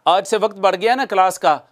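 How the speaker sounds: normal talking voice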